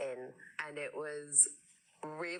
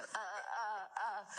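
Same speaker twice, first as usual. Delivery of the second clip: caught-out stammering with a higher pitch; flustered stammer; 4 semitones higher, much slower than usual. Retold or imitated. Actress showing how she freezes on favourites, stammering. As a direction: stall like a deer in headlights. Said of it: "deer in the headlights and go".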